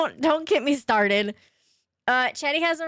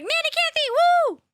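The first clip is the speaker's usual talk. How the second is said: much higher whooping cheer of Nana Kathy; cheering shout; 5 dB louder, faster than usual. Pretend. Streamer pretends to cheer on viewer Nana Kathy, whooping. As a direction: cheer her on like a fan in the stands. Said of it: "I'll randomly just be like".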